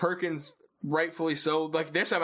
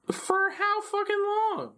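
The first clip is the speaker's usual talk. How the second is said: much higher mock-incredulous pitch; exasperated question. Axe challenging the others' plan, high mock disbelief. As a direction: jump way up in disbelief. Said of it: "And he's like".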